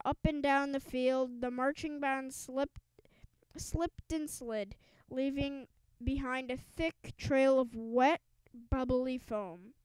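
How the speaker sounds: plain reading voice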